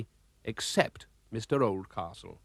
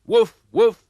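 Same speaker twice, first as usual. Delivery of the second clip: high, loud barks; dog barking; 10 semitones higher, 10 dB louder than usual. Acted. Horace the dog joins the impatience, loud high woofs. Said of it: "So was his dog, Horace".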